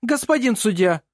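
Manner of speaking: high, urgent call to the judge; jumping-up appeal. A narrator's reading. Opposing lawyer jumps up before the judge, urgent. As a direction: burst in with an objection. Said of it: "вскочил Сёренсен".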